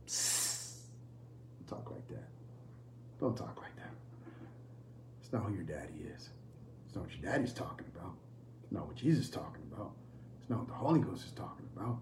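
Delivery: very low, hushed near-whisper; whispered rebuke. Acted. Preacher gently checking talk of darkness, hushed whisper. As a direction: whisper it as a secret correction.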